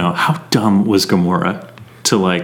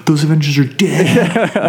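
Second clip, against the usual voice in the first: laughing through it; 6 semitones higher than usual. Retold or imitated. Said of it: "then you would have me going".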